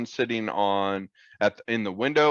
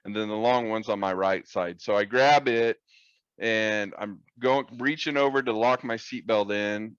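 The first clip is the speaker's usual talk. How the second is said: ordinary talk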